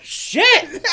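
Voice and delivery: high, loud startled exclamation; stunned cook's blurt, hammed up; mock shock. Acting cook's shocked reaction.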